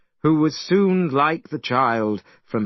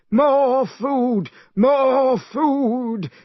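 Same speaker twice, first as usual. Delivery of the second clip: high, loud greedy call for more; 11 semitones higher, 4 dB louder, much slower than usual. A narrator's reading. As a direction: shout to the servants for more food. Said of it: "As Pigsy was calling".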